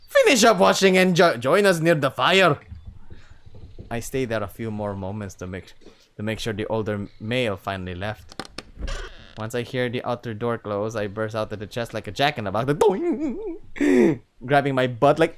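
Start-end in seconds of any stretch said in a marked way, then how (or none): 0.1-2.6 loud, raised-pitch Scottish attempt; a try at a Scottish father; Scottish accent. Chief to his son as a father, attempted Scottish accent. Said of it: "give him a Scottish accent. I'll try". 12.7-13.6 high, wobbling boing noise; springy boing. Retold or imitated. Narrator bursting out of the chest, comic boing. Imitating jack-in-the-box spring.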